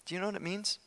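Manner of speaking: usual speaking voice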